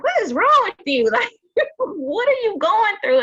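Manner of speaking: husband's question laughed out high; laughing husband quote. Retold or imitated. Retelling her husband's puzzled question while laughing. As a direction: quote him through giggles.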